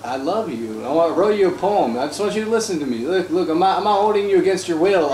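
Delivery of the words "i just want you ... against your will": fast pleading. Pretend. Pleading with trapped girl.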